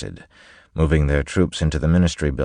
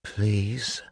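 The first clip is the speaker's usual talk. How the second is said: soft, higher-pitched woman's plea; very quiet plea; 6 semitones higher, 5 dB quieter than usual. Acted. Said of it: "the woman said very quietly".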